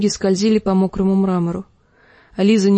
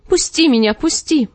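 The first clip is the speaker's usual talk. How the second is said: высокий визгливый вскрик «пусти»; завопить, выше своего голоса; вопль; 6 semitones higher than usual. A narrator's reading. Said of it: "завопила Лиза". (Лиза вопит на краю пропасти)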